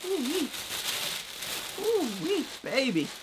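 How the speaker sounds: high, loud whoops of delight; excited whooping. Real, not acted. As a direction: whoop it up over the reveal. Thrilled at the unboxed item.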